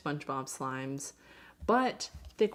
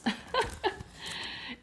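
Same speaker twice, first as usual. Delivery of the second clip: breathy laugh. Real, not acted. Laughs after mentioning hiccups.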